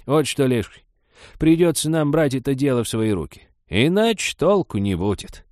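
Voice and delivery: firm, high granny declaration; decisive. Acted. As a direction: announce it as settled. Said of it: "решительно объявила".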